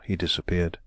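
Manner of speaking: usual speaking voice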